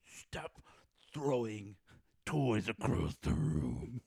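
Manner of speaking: slow, seething scolding that swells from a tight whisper to loud; hammed-up furious dad, dragging out each word; upset parent. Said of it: "I still get upset".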